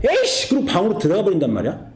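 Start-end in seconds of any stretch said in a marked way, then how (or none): 0.1-0.5 sudden high-pitched mock curse; petulant, acted burst of annoyance; sulky teen outburst. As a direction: imitate a scolded boy storming off. Acted son storming off when scolded.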